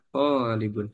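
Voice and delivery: Tolibun with the first syllable to drawn out long. Shown as a demonstration. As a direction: stretch the to of Tolibun like a teacher's model. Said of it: "tonya panjang".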